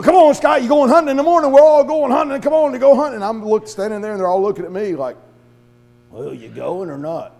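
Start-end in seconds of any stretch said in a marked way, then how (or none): 0.0-3.2 loud, high, pushy invite; loud eager urging. Retold or imitated. Barry loudly urging the preacher in the vestibule. 6.1-7.3 low, slow, flat demand; low impatient challenge. Retold or imitated. Hunting buddies staring down the preacher, low and slow.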